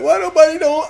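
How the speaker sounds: mimicking whiny plea. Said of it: "I'm mimicking the way he was talking".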